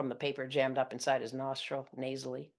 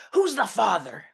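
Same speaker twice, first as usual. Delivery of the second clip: dad's bellow; 8 semitones higher, 10 dB louder than usual. Retold or imitated. Father bellowing at his pregnant daughter over the phone. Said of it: "my dad bellows".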